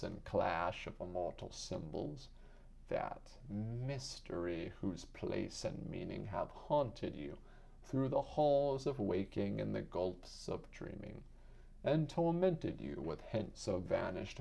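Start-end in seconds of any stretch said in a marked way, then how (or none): none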